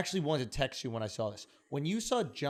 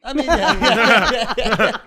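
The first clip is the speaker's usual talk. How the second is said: laughing yeah; 6 semitones higher, 13 dB louder, slower than usual. Real, not acted. Laughing agreement, loud.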